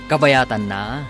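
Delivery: normal talking voice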